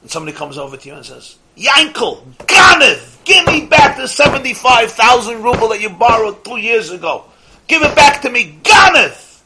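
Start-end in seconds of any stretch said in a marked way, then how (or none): 1.6-9.4 high, loud furious accusation of a thief; angry yelling. Retold or imitated. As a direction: bellow at him, demanding your money back. Creditor yelling at the wrong man.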